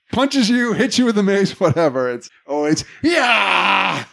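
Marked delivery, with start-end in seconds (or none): 3.0-4.0 high battle cry yell; tennis grunt. Retold or imitated. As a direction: let out a high warrior grunt. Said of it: "Screams no matter what".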